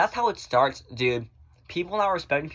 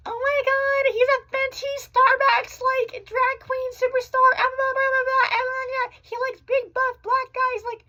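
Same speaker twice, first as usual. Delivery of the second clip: high, loud squealing mock voice; mocking falsetto; 5 dB louder than usual. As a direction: squeal it like a gushing gossip. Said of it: "But I hate when people are like".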